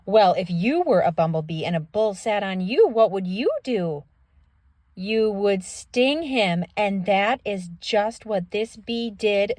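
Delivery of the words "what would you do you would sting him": ordinary delivery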